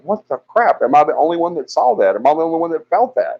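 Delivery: pitched high, asking in panicked disbelief; the ground just rolled and nobody else noticed; freaked-out disbelief. Retold or imitated. Asking passers-by after the quake, in high alarmed disbelief. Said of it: "So I'm like".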